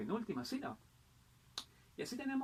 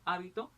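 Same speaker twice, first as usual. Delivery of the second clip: hábito said HÁ-bi-to; stress on first syllable; 4 semitones higher, 3 dB louder than usual. Shown as a demonstration. Teacher giving accent examples, hábito stressed on HÁ. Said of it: "el acento puede variar".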